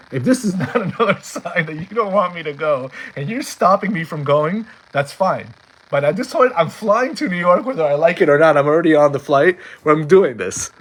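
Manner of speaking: worked-up, determined protest; defiant plea. Retold or imitated. Acted defiant plea to Hashem.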